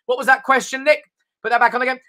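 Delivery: ordinary talk, nothing marked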